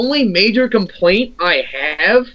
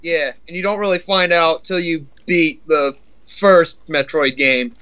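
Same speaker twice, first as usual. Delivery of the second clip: ordinary delivery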